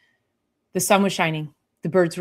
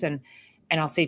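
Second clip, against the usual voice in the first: normal talking voice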